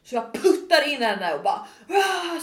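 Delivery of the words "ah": high mock scream; retold scream. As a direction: let out a re-enacted scream, half laughing. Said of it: "skriker och sen gråter jag". (återgivet, högt skrik)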